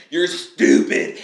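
loud breathy mock insult, slowed; mock road rage. Imitating a driver cursing another car, mock loud scorn. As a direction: spit it out at the driver.

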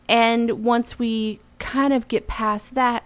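ordinary talk, nothing marked

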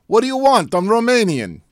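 mock-offended shout of a fake Romanian; imitating an insulted Romanian vampire, loud and high; indignant Romanian accent. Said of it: "he's like".

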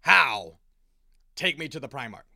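a very loud, commanding How; forceful shout. Acted. Garrow demanding to be taken to Dorn, forceful shout. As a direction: bark the demand at him.

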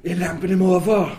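put-on loud and high in a Bohan accent, a shouted warning; policeman calling out. A Bohan policeman shouts to his partner about the albino. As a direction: bellow it across the square in Bohan speech.

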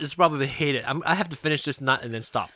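chewing while talking; eating a nut; mouth full. Real, not acted. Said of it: "I'm munching".

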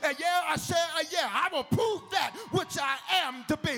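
high, loud preacher crescendo; full-force preaching. Real, not acted. Preacher urging the church to celebrate others, impassioned.

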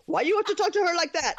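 mock indignant Michael. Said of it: "was like".

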